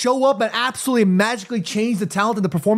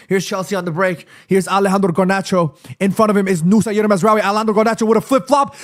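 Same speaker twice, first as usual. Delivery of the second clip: ordinary talk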